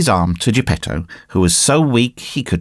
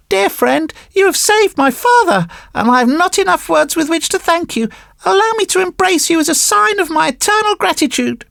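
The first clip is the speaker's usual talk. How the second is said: high-pitched, earnest puppet voice; grateful puppet boy. Acted. Puppet boy thanks the fish for saving father, high and grateful.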